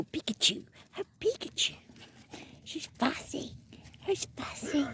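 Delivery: high breathy whisper to the puppy; whispered pet talk. Real, not acted. Whispering to the tiny fussy puppy, high soft coo.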